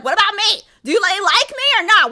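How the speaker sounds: high, loud, demanding mock yell; hammed-up outraged screaming. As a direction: scream the question like a jilted girlfriend. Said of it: "lightheaded right there screaming".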